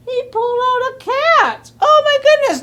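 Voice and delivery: high-pitched storyteller's shock; wide-eyed, high discovery; astonished. A narrator's reading. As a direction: exclaim in amazement, voice jumping up. Storyteller reacts to the cat.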